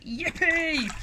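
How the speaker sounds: high, gleeful whoop; cried-out cheer. A narrator's reading. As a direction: cheer at the lucky discovery. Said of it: "the T's cried out".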